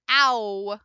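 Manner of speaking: ow sounded loud from open ah; modeled ow. Shown as a demonstration. Teacher modeling ow for students. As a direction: say ow with a full glide. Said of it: "So you're starting here".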